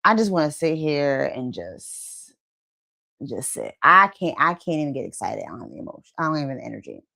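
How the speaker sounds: trailing off, low and spent, dropping to almost nothing; drained and exhausted. Retold or imitated. Burned-out woman voicing her own exhaustion, drained and fading. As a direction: sound like you have nothing left to give. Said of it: "you're just like".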